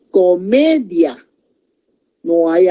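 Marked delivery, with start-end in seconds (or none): 0.1-1.2 "comedia" drawn out slowly by syllables; slow example word. Shown as a demonstration. Teacher giving "comedia" as an example, slowly sounded out. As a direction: stretch "comedia" so each syllable shows. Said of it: "Yo puedo decir".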